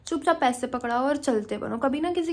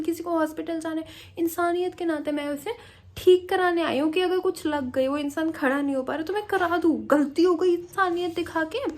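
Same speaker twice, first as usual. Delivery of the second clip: higher, indignant shouting; heated retold rant; 5 semitones higher than usual. She shouts at the delivery men that she only helped out of humanity. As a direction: fire back in outrage at their ingratitude.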